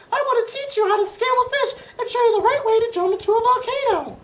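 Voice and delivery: shouting bread. Acted. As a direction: proclaim it like a frantic loaf father. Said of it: "the bread is shouting back to the boy".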